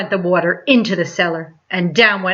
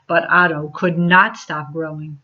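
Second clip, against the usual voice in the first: ordinary delivery, nothing marked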